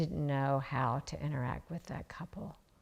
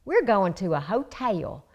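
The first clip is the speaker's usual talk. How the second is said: put-on playful Southern accent; 3 semitones higher, 5 dB louder than usual. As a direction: ham up a Southern accent for a child. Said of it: "we were using our Southern accents".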